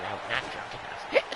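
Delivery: breathy whispered joke; whispered aside. Acted. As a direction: whisper it like a confession. Joking aside about being drunk.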